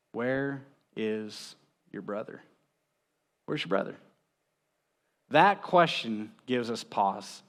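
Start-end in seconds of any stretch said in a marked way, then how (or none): 0.1-2.4 a slow, hushed question; slow and soft. Acted. God asking Cain where his brother is, slow and hushed. 3.5-4.0 a soft whispered echo. Acted. God pressing Cain again, in a whisper. God's question repeated.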